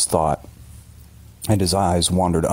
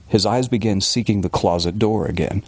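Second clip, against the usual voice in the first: ordinary delivery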